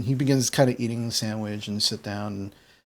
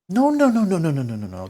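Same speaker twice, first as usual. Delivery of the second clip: high start tumbling down in pitch; hasty denial; 5 semitones higher, faster than usual. Acted. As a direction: brush off the accusation with a rapid string. Gram hastily denies poisoning him.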